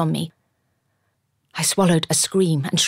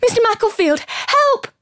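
very high, loud panicked call; grabbed in the street, she shrieks for the tailor's help; cry for help; 5 dB louder than usual. A narrator's reading. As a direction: shriek for the neighbour to come.

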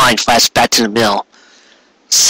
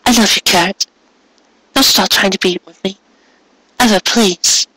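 put-on young mare, gentle; 8 semitones higher than usual. A narrator's reading. Mare tells Carrot she loves him.

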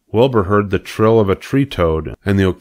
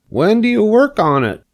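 much higher, pleading pig voice; begging; 3 dB louder than usual. Acted. Pig begging the spider about her plan, high pleading voice. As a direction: beg her anxiously for an answer. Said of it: "begged Wilbur".

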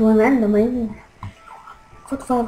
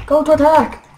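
shouted order, louder; loud command; 4 semitones higher, 9 dB louder than usual. Real, not acted. Commanding ally in game.

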